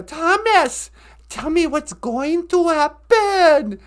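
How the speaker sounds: hammed-up terrible actress; Thomas Builds a Fire's grandma. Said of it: "her acting is just, it's off the charts terrible".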